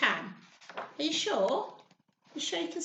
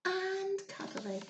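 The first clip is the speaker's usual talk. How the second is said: tender coo; 5 semitones higher, 9 dB quieter, slower than usual. A narrator's reading. Cooing over tiny mammoth.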